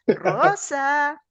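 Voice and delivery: "Rosa" alto y agudo, cantado; respuesta del alumno. Retold or imitated. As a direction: responde "Rosa" llamando en voz alta. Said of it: "Y él me responde". (el alumno contesta con un llamado alto)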